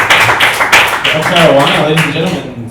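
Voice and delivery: loud, slow announcement; host announcement. Real, not acted. Host wrapping up the bit for the audience, loud and slow. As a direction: call it out to the room.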